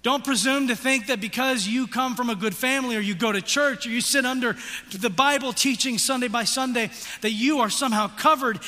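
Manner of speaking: loud, high, driving sermon voice; fiery preaching. Acted. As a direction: press the guilt home from the pulpit. Preaching at full force.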